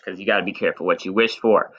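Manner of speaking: usual speaking voice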